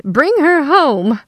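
high, loud, slow command; insistent demand. A narrator's reading. Mother demands her daughter back, slow and forceful. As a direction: draw out each word imperiously.

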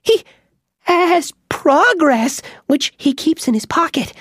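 high squeaky mouse voice, nervous boast; squeaky mouse bragging. Acted. Mouse Bobbin boasting of his protector to the witch, squeaky and nervous. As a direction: puff up a tiny voice to sound protected. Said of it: "he added".

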